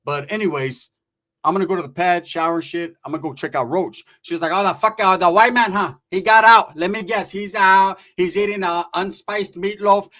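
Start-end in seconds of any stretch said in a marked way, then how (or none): 4.5-10.1 imitated aunt. Aunt mocking friend's release.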